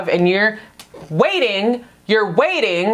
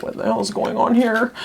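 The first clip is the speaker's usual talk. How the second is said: puzzled exclaim; 3 semitones higher than usual. Retold or imitated. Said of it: "I was like".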